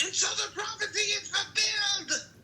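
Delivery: high dramatic witch cry. Acted. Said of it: "And the witch cried out".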